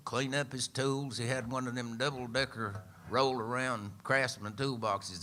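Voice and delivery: normal talking voice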